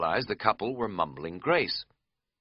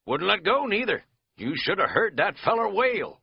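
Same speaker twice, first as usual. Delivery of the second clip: lightly raised, drawling country host; folksy old innkeeper; 6 semitones higher than usual. Acted. Innkeeper telling the hiker about his dog, folksy country voice. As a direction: spin the dog yarn like a backwoods innkeeper.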